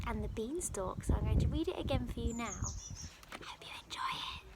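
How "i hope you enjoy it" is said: whisper. Real, not acted. Teacher closing intro.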